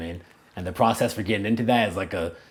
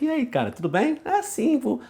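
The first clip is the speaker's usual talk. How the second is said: much higher, sing-song Brazilian Portuguese; warmly empathetic Brazilian accent, pitched way up; touchy-feely Brazilian Portuguese. Shown as a demonstration. Showing Brazilian mannerisms when speaking Portuguese, touchy-feely Brazilian. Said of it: "empathetic, I'm more, like, touchy-feely".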